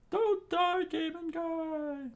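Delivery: very high, loud plea, slow at the end; pleading high voice. A narrator's reading. Gin begging Sanji not to die, high loud plea. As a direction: beg him in a squeaky cry.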